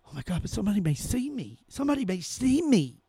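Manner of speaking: mock a scared sheep; mock coward.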